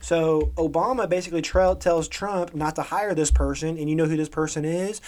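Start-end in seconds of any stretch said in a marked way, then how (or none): none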